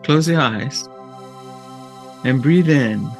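ordinary delivery

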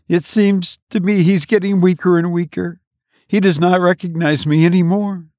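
high, worried woman's voice; a wife fretting over her dying husband; anxious wife. A narrator's reading. Wife answers her brother, high and worried.

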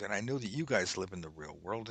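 ordinary talk, nothing marked

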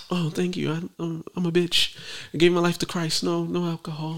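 soft meek refusal; timid. Retold or imitated. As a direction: turn it down meekly. Retold own polite refusal.

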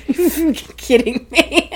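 fast, high, loud through laughter; laughing disbelief. Retold or imitated. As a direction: crack up in mock exasperation. Laughing at toddler's ill-timed announcement.

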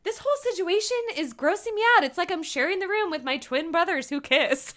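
imitating Alexis. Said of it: "Alexis is like".

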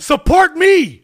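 loud, slow chest-beating yell; Tarzan yell. Acted. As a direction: bellow it like Tarzan.

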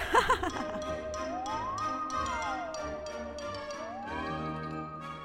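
high jeering laughter; mocking laugh. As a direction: laugh at him scornfully. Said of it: "استقبلاه بالسخرية".

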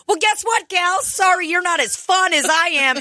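high-pitched taunting mom voice; partying mom. Acted. Co-host voicing a mom up front answering her daughters, high-pitched taunt. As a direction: brag in a high, put-on voice.

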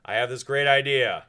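loud, eager pitch of an idea; booming friendly salesman; Tim Misney voice. Retold or imitated. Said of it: "that's what you're getting today".

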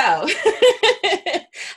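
high, loud burst of laughter; big high-pitched laugh. Real, not acted. Host laughs before resuming.

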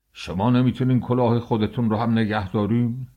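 a boss snapping at a nuisance; angry boss shout. Acted. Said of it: "یارو خیلی عصبانی بود، داد کشید". (رئیس عصبانی با داد ملایم)

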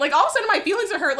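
high, fast indignant rush; mock offended. Real, not acted. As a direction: blurt it out, pretend hurt. Mock hurt feelings.

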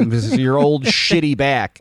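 mock teasing quote. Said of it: "You say".

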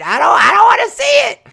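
high fast shout, like a fan who can't bear to watch; mock horrified shout. Fan dreading the wedding, mock horrified shout.

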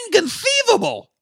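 very high, squawky catchphrase impression; put-on voice of outraged disbelief; hammed-up high-pitched exclamation; Wallace Shawn. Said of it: "Gilbert is voiced by Wallace Shawn".

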